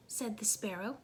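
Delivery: ordinary delivery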